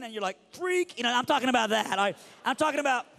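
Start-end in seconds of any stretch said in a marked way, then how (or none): 0.5-1.0 high, loud dismissive jab; hammed-up revulsion at a crybaby; mock disgust. Mimicking the disgusted girlfriend's reply.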